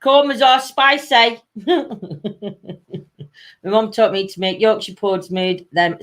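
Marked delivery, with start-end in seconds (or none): 1.5-3.2 softer trailing laugh; long, softer giggle. Real, not acted. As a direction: chuckle it out. Amused by the chat.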